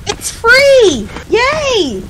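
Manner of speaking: high, squealed delight; gleeful cheer. Real, not acted. Player finding out it is free, high gleeful cheer. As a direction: squeal with joy.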